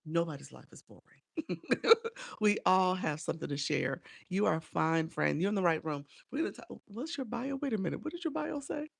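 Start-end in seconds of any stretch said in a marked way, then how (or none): none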